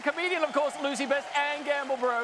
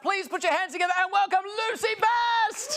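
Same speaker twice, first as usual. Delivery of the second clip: announcer welcome; 6 semitones higher than usual. Acted. Host introducing the comedian, announcer shout. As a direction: hype the crowd for the next act.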